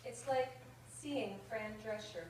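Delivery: measured, rhythmic verse reading; comic poem read to an audience. Acted. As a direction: read the light verse line by line, savouring rhymes. Reads a comic poem aloud.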